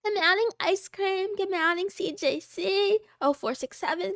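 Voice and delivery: ordinary talk, nothing marked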